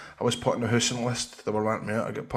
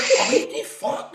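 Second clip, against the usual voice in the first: laughing disbelief; slower than usual. Retold or imitated. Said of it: "I was like".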